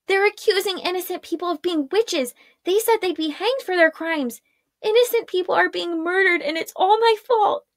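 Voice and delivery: put-on a young woman near tears; distraught Arella. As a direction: spiral into self-blame.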